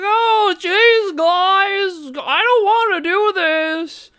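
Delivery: very high, slow, whining cry; grating whiny voice. Retold or imitated. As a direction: squeal it like a nasal whiner. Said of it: "the most grating voice ever".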